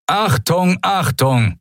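booming loudspeaker announcement; over a PA system. A narrator's reading. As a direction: thunder it out over the speakers. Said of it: "donnerte ... über versteckte Lautsprecher".

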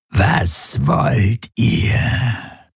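slow, rasping monster whisper; whispery creature voice. A narrator's reading. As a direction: breathe it like a skeletal ghost. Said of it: "seine Flüsterstimme".

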